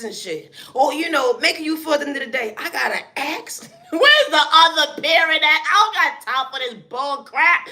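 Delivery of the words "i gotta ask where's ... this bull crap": higher and louder, exasperated parent rant; a put-upon guardian losing patience; fed-up parent. Acted.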